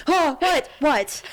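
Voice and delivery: mock gasp of panic, high and loud; high-pitched startled yelp; startled awake terrified. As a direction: imitate someone jolting awake terrified. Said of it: "he'll wake up like he is about to be murdered".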